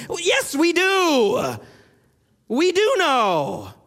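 very slow, each word weighed, yes set high; slow firm insistence. Real, not acted. Preacher answering professors who said we don't know, slow and firm.

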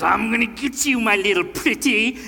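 lightly voiced cackling witch threat; a playful nod to the wicked witch; hint of a wicked witch. Preacher voicing Jezebel as a mock witch, lightly. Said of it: "when Jezebel says".

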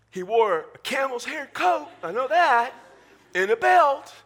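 put-on high, loud child insisting on the answer. Child asked about John the Baptist, loud insistent answer. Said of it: "You ask a child".

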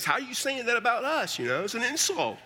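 indignant on Texans' behalf; mock indignation. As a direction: act out taking offense, voice rising high. Acting out offended Texans' protest.

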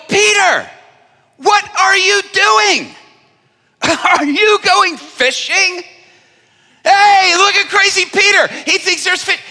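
high, loud jeering taunt; hammed-up mockery from the shore; mocking fishermen. Fishermen on shore jeering at Peter loudly. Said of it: "They're going".

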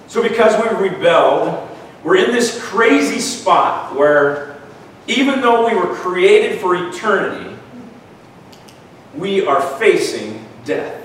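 a touch of rising preacher fervor; preaching. Acted. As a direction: build the sermon with passion. Preaching builds up.

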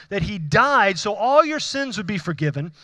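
usual speaking voice

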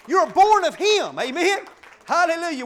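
loud, ringing declarations to the church; preaching at full force. Acted. Preacher declaring blessings to the congregation, loud. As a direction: proclaim each line like a blessing.

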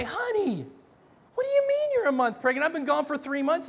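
high, incredulous outburst; a husband stunned to hear a month pregnant; shocked husband. Pretend. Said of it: "This fellow wouldn't be a happy camper".

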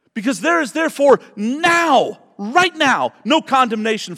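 loud, high preaching shout; fiery pulpit declaration; preaching at full force. Real, not acted. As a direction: lift the congregation with it.

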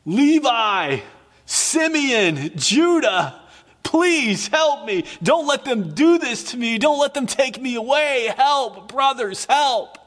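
high, strained cries for help; desperate pleading. Acted. Joseph led away in chains, begging his brothers: high, desperate pleading. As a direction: beg your brothers not to let them take you. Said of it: "and he's begging with them, and he's pleading with them".

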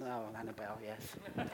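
a low, hushed aside; quiet muttered aside. Retold or imitated. Pastor mimics himself as the woman approaches, quiet aside.

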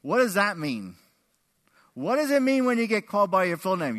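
ordinary talk

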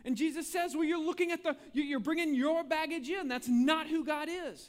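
preaching at full force. Acted. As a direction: drive the point home to the congregation. Preacher raises voice again.

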